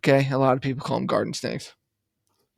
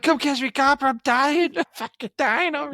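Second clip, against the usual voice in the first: high-pitched hammed-up panic; mock frantic yelling; 11 semitones higher than usual. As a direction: pretend you're dying and shout it at a cop. Acts out yelling to a cop while speeding.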